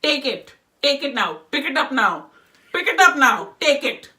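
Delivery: put-on loud, Indian-accented grandmother ordering; scolding grandmother with Indian accent. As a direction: fire off irritated commands in an Indian accent. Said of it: "I'll do the Indian accent".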